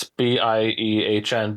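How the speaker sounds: B I E H N spelled letter by letter, slowly; slow, separate letters B, I, E, H, N. Shown as a demonstration. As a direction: spell B I E H N out one letter at a time. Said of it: "but it is pronounced Bean".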